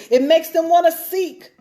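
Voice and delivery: normal talking voice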